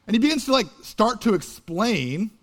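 ordinary delivery

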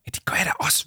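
whispered admission. Acted. Jama admits a secret to Arthur, whispered. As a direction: confess it under your breath. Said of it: "hviskede Jama".

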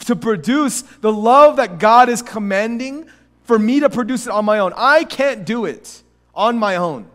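high, loud, impassioned confession; full-force preaching. Real, not acted. Preacher confessing he can't do it alone, full-force preaching. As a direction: preach your helplessness at full volume.

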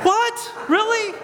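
high-pitched incredulous questions; mock disbelief. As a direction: react as if appalled by the idea. Acts out disbelief at riding again.

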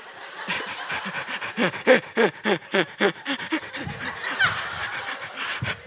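long, helpless run of chuckles; real laughing fit. Real, not acted. Preacher after an unplanned joke, real sustained laughter. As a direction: break up laughing at your own joke.

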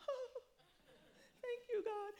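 high, hushed grateful "oh, thank you, God"; serious son coming out of baptism water, awed. Retold or imitated. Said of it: "He was like".

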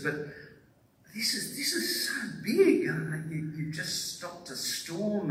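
high, baffled, stumbling disciple; a disciple overwhelmed, high and stammering; bewildered disciple. Retold or imitated. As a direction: voice a flustered follower at a loss. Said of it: "I'm with the disciples saying".

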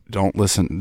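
usual speaking voice

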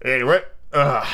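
usual speaking voice

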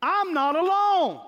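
rising to a high, loud cry of I'm not alone; preacher crying out in faith; building cry. Acted. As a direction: swell from steady to a triumphant cry.

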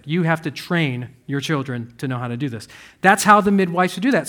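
usual speaking voice

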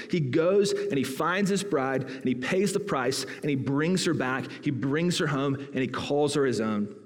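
ordinary talk

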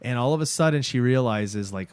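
ordinary talk, nothing marked

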